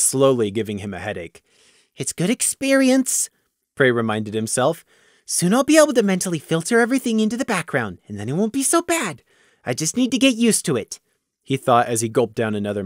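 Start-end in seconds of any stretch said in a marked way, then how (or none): 2.0-11.0 put-on higher, smug young voice; smug young pony. Prey coaching himself in thought.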